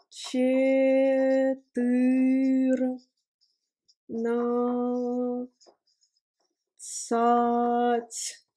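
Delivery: Четырнадцать stretched out very slowly, syllable by syllable; slow it right down so learners hear each sound of Четырнадцать; drawn-out syllables. Shown as a demonstration. Teacher to students, sounding out the word very slowly. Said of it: "slow model pronunciation".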